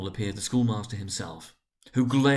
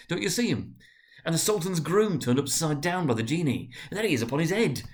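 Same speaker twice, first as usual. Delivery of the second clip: higher, eager, half laughing half tearful; between laughing and crying; 6 semitones higher than usual. A narrator's reading. Scrooge recalls storybook friends, voice between laughing and crying. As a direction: gush like a delighted child near tears. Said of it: "voice between laughing and crying".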